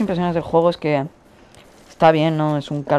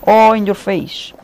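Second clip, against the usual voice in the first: slow, louder gloating jeer; taunting; 4 semitones higher, 5 dB louder, much slower than usual. Real, not acted. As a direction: rub it in slowly. Taunting an enemy after kill.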